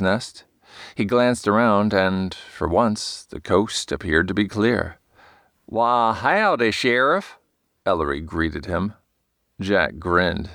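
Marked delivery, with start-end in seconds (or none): 5.6-7.4 put-on cowboy greeting, slow and stretched, Western accent; a mock Western twang, drawn out; hammed-up Western accent. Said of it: "Ellery's idea of a Western accent was pure bonanza".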